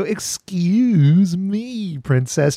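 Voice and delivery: very slow, high mock quote; drawn-out catchphrase. Host showing the three-second catchphrase, exaggerated drawl. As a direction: stretch "excuse me" long and high. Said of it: "Just this three-second long thing".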